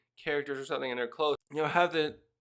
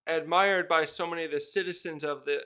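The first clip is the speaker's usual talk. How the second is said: ordinary talk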